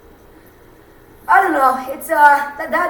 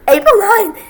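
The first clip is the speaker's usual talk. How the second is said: voice crack; 9 semitones higher, 8 dB louder than usual. Real, not acted. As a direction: pitch cracking high on April ninth. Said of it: "you hear that voice crack".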